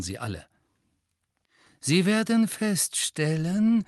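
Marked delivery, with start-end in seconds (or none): 1.8-3.8 slow, sneering teacher voice; soft sneering voice. A narrator's reading. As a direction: drawl it with quiet scorn. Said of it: "sagte Snape leise und höhnisch".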